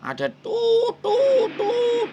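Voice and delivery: high squeaky beep noises; imitated beeping. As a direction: mimic a phone line beeping. Mimicking beeps on the line.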